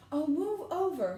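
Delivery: high, loud, drawn-out exasperation; snarling. A narrator's reading. Hermione pushing past to the locked door, snarling. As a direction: snarl it loudly, dragging the words. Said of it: "Hermione snarled".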